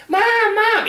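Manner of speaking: put-on very high, loud child's call; kids calling out. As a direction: call across the rink like little kids. Kids calling at skating rink.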